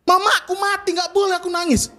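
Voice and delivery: high-pitched, aggrieved outburst; indignant protest. Acted. Bereaved son answering those forbidding tears, indignant. Protesting being told not to cry.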